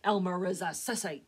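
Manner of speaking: loud, cold contempt; contemptuous father. A narrator's reading. Papa to Mama, loud and scornful. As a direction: spit it out with scorn.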